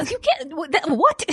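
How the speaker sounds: high, sputtering disbelief; stunned disbelief. Retold or imitated. Grandson reacting to grandma's excuse, stunned. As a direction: lose your words in shock. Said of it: "I was like".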